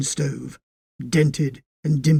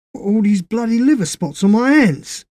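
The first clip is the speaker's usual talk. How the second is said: raised, alarmed outcry; louder and higher in sudden alarm; cried out; 8 semitones higher, 7 dB louder than usual. A narrator's reading. Les discovering spots on his hands, alarmed cry. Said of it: "he cried out".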